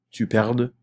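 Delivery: "tu perdes" ending on an audible D, silent S; final D pronounced. Shown as a demonstration. French teacher drilling subjunctive forms, final D pronounced. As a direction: sound out the D that closes "perdes". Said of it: "putting emphasis on the D".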